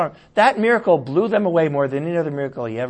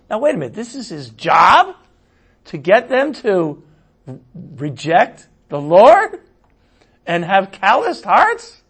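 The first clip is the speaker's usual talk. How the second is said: raised, questioning pitch, slowing with each phrase; mock disbelief; 4 semitones higher, 5 dB louder than usual. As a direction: play the incredulous objector, each question rising. Voices a baffled objection to scripture.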